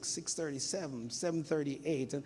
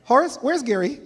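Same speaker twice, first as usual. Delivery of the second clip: high, loud call across the house; mother calling her husband at the door, loud call; 7 semitones higher, 9 dB louder than usual. Retold or imitated. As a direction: shout his name to find him.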